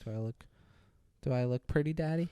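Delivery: lightly imitated coy woman, hushed; quiet little put-on simper; coy question. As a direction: ask softly, playing mock-demure. Voicing woman playing coy to him.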